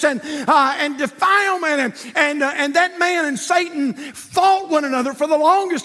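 high, loud storytelling preacher; preaching at full force. Acted. Preacher builds the story loudly.

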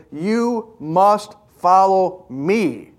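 "must" leaned on, slow; slow it down and press on "must"; emphatic command. Shown as a demonstration. Preacher showing the Greek emphasis, slow and emphatic. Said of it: "This is the most emphatic way".

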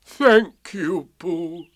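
high, mournful donkey voice; heavy, gloomy gratitude; Eeyore. A narrator's reading. Eeyore thanking Pooh, gloomily grateful. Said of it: "answered Eeyore".

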